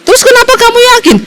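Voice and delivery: high, fast disbelieving question; heran mendesak. Retold or imitated. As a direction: fire it off in disbelief. Suami heran, cepat. (suami yang heran bertanya cepat, tinggi dan tidak percaya)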